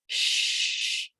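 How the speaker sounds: sh held long as a hushing sound. Shown as a demonstration. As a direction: draw out sh on its own. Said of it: "can you sound it out?".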